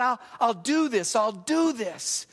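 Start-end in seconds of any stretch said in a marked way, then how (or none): 1.1-2.3 slow resolve. Retold or imitated. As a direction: let the resolve sink in slowly. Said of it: "You can see him openly wrestle with this".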